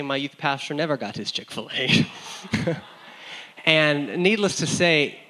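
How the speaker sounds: ordinary delivery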